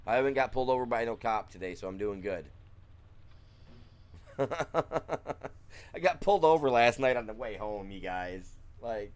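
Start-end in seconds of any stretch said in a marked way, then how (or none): 3.6-5.9 unvoiced breathy laugh; airy laugh. Real, not acted. Telling chat about the cop, airy wry laugh. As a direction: laugh it off wryly.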